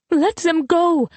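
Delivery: high, drawn-out outcry; impulsive cry. A narrator's reading. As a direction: blurt it out in a slow, high cry. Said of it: "Colette cried before she could stop".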